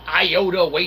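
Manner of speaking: ordinary talk, nothing marked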